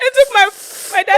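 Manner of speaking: high, loud, laughing. Real, not acted. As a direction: laugh through the words. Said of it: "still laughing hard".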